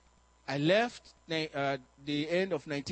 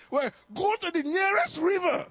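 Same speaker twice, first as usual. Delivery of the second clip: higher, louder sudden command; blurted order; 12 semitones higher, 6 dB louder than usual. Retold or imitated. Preacher to the demon, higher louder order. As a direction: fire off an answer.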